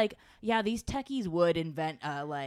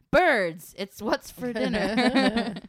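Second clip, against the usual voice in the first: mock ad slogan; 5 semitones higher, 9 dB louder, much slower than usual.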